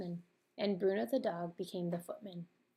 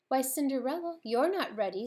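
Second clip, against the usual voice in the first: louder, higher mock astonishment; feigned surprise; 6 semitones higher, 7 dB louder than usual. A narrator's reading. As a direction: act surprised, all for show. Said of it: "sounding surprised".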